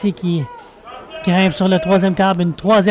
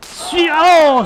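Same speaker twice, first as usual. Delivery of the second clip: surprised; 7 semitones higher than usual. Real, not acted. Surprised reaction mid-call.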